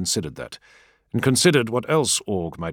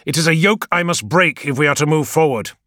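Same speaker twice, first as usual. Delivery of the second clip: louder and higher, heated resolve; rising anger; 9 semitones higher, 7 dB louder than usual. A narrator's reading. Leader vowing to break the council, angrily rising. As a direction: push through, anger mounting. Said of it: "his anger only rising".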